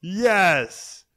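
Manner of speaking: high, loud, elated cheer; jubilant shout. Real, not acted. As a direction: cry out in celebration. Celebrates the hockey win.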